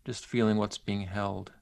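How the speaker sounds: unhurried and gentle, leave long pauses; centering exercise. Acted. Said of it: "lead you through a, a brief centering exercise".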